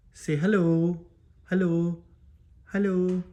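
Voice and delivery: high-pitched pet-training call; coaxing a pet. Real, not acted. Prompting pet to repeat words.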